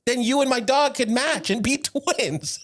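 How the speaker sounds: high and slow, laughing through it. Retold or imitated. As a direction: giggle out the line.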